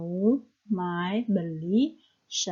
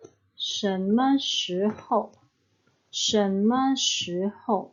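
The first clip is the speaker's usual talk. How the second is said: slow model phrase; much slower than usual. Shown as a demonstration. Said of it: "Dalam bahasa Mandarin".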